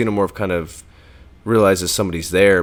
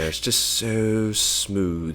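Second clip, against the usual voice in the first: smooth; 6 dB quieter, much slower than usual. Real, not acted. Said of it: "chocolatey English accent".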